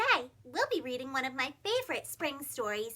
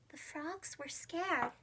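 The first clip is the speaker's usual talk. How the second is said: quiet; 9 dB quieter, slower than usual. A narrator's reading. Scary flood narration.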